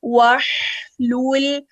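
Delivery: वहलुल drawn out slowly with ha given time; slow, voiced ha. Shown as a demonstration. Teacher showing students the correct, slow reading. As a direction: stretch the ha in वहलुल. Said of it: "हा है उसको time देंगे".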